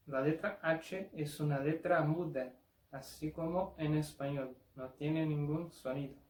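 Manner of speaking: normal talking voice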